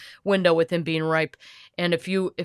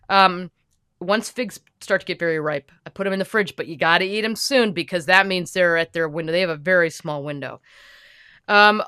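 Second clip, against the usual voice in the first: ordinary talk, nothing marked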